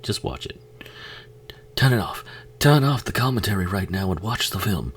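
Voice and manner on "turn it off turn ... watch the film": high, loud, insistent order to turn off commentary; urgent loud plea. Real, not acted. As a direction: push them to turn it off, louder. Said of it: "urging viewers".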